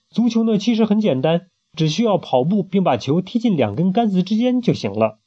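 lightly voiced character explaining football. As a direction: explain the game brightly. Character quote in read story.

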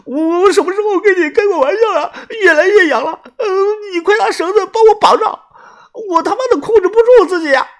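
high, loud, half-crying panic; frantic shouting. A narrator's reading. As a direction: scream for them to tie him up. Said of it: "大声地说".

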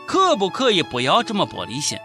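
ordinary delivery

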